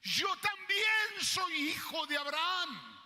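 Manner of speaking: put-on slow, high, loud wail of a desperate boy; crying out. Boy in the desert crying to heaven, loud anguished wail. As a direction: cry it out like an abandoned child. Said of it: "en el lloro gritaba".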